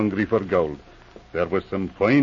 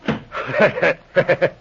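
warm, delighted belly laugh; a pleased laugh after a prank; hearty chuckle; 6 semitones higher than usual. Acted. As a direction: laugh at the trick you just pulled. Trick on inspector works.

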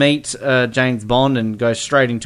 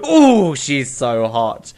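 impressed Bond; 3 dB louder, much slower than usual. Retold or imitated. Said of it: "he's kind of like".

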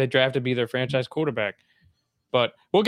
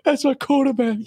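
higher, louder tearful plea; imitating T O; 9 semitones higher, 5 dB louder than usual. Host imitating a tearful T.O. to co-hosts. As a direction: choke it out like T O. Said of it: "like, T O".

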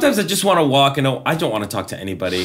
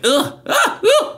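high yappy grunts of annoyance; startled yelps; slower than usual. Retold or imitated. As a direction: yelp like a jumpy little dog. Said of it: "you're sounding like my chihuahua right now".

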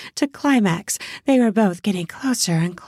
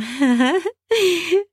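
high rising laugh; 10 semitones higher than usual. Acted. Woman laughs at teasing.

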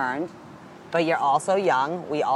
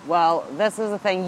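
usual speaking voice

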